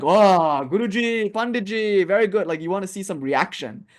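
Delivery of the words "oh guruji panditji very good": high-pitched, impressed pupil act; put-on awe of a devoted student; admiring pupil. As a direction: gush over the teacher, mock-admiringly. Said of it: "you want to see them like".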